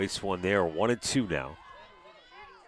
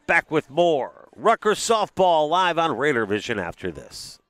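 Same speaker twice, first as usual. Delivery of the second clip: ordinary delivery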